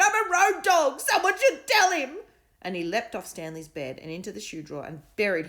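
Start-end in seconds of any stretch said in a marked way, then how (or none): 0.0-2.2 high, loud whiny dog voice; upset dog. A narrator's reading. Dog pleading with the kids, upset. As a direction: plead your case. Bennett continues.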